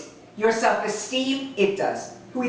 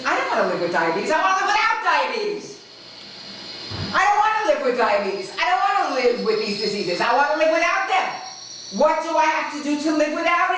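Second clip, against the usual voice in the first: lightly voiced pleading woman, higher; a hint of a desperate interviewee; mild imitation of a woman begging for help; quoted woman; 8 semitones higher than usual. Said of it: "and she says".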